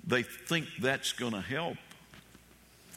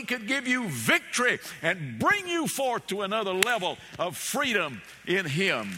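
fervent revival preaching, climbing high; preaching at full force; 5 semitones higher than usual. Acted. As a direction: lift the congregation, climbing in pitch. Preacher builds to a climax.

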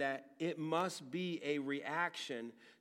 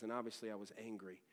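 ordinary delivery

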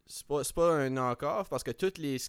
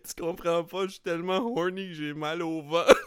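laughing; 6 semitones higher, 7 dB louder than usual. Retold or imitated. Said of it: "puis il est comme".

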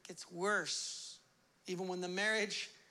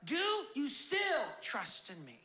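raised, driving pulpit cadence; full-force preaching; 9 semitones higher, 3 dB louder than usual. Real, not acted. Preacher tells believers God is testing them, impassioned. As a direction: build with urgent, emphatic phrases.

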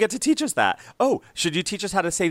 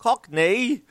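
high-pitched, badly faked Cockney; comic bad Cockney, pitched way up; terrible Cockney accent; 9 semitones higher, 3 dB louder than usual. Shown as a demonstration. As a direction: attempt a Cockney accent and miss. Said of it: "I have a terrible Cockney accent".

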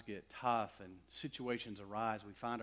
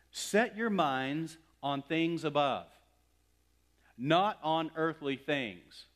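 loud, slow stress on "Set your minds" and "not"; emphatic scripture command; 4 semitones higher, 8 dB louder, slower than usual. Real, not acted. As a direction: punch "not on earthly things", slowly and firmly. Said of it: "emphasize this".

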